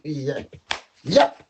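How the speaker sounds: normal talking voice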